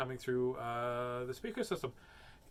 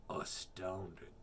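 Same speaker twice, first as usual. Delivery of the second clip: slow, softer low voice; hushed awe; 7 semitones lower, 6 dB quieter, slower than usual. Acted. Gruffalo astounded, slow hushed voice. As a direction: breathe it in wonder.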